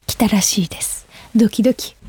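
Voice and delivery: hushed secret whisper. Real, not acted. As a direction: whisper it with excitement. Surprise flowers arriving. (ひそひそ声で)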